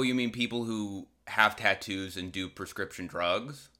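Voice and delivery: ordinary talk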